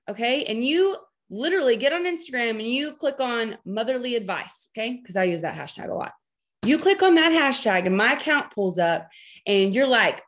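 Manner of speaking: normal talking voice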